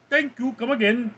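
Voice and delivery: high-pitched imitation Indian accent. Shown as a demonstration. Streamer answering a viewer's request, high mock Indian accent. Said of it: "Can you do Indian accent?".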